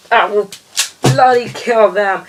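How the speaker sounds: mock villain going over the top; escalated villain threat. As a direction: push the threat further, almost gleeful.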